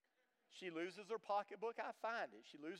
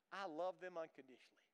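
ordinary talk, nothing marked

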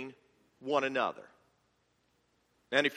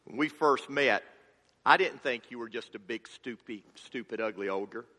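gentle Donkey; 6 semitones lower than usual. Retold or imitated. Pastor voicing Donkey reassuring Shrek by the campfire. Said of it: "and he says".

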